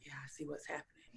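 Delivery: a quick breathy whisper to herself; whispered reply. Retold or imitated. Speaker answers her spirit inside her head, whispering. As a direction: whisper it fast, like answering a secret voice.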